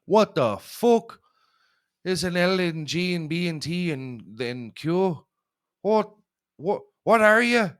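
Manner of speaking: a bumbling, shouted stab at an Irish brogue; bad Irish accent. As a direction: ham up a clumsy Irish dad accent. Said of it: "I can't do a good fucking Irish".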